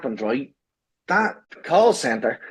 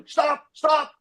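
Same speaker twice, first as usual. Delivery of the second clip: high yelled stop; shouting at the sky; 13 semitones higher than usual. Retold or imitated. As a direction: shout it upward. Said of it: "I was shouting, like, up into the sky".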